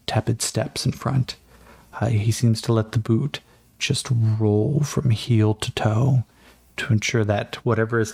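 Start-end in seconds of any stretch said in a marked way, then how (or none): none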